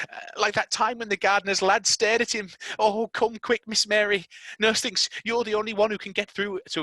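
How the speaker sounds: high, urgent rushed worry; a maid in a panic; frantic maid. Acted. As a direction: maid urging Mary to come to Colin. Martha voiced, urgent.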